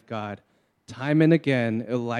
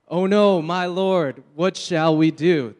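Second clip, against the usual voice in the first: higher, louder panicked cry; alarmed servant; 5 semitones higher, 6 dB louder than usual. Acted. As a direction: servant sees the army and panics, alarmed and raised. Servant panics seeing enemy army.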